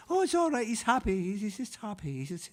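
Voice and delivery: a mother gently explaining it's all right; reassuring mother. Retold or imitated. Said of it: "my mother would be saying".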